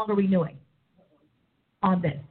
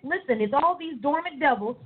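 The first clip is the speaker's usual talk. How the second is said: usual speaking voice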